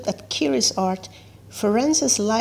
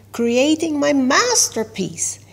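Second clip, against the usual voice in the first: higher and proud, a bright exclamation; the beetle proudly exclaiming to Ference; 7 semitones higher, 3 dB louder than usual. A narrator's reading. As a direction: announce your masterpiece with delight. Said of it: "exclaimed a beetle".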